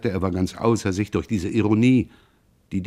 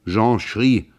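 ordinary delivery, nothing marked

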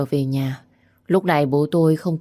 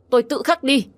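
defiant retort; 9 semitones higher than usual. Acted. Wife retorts to husband.

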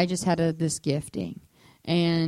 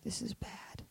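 whisper; 5 semitones higher, 18 dB quieter, slower than usual. Retold or imitated. Panicked inner thought, whispered aside.

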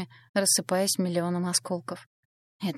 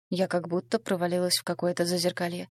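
ordinary delivery, nothing marked

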